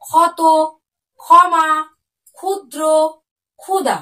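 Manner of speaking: each word started with a strong aspirated kh, ক্ষত and ক্ষমা loudest; list of demo words. Shown as a demonstration. Teacher recapping example words for children, crisp and clear. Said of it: "দেখো, যেমন".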